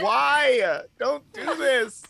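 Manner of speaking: high, drawn-out mock plea; mock pleading. Viewer pleading with the show over the hurt girl, mock anguish. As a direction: beg them to stop, hammed up. Reacting to pitiful scene, pleading.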